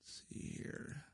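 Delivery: breathy whisper while clicking around; whisper to self. Real, not acted. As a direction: whisper it under your breath, searching the screen. Muttering to himself at computer.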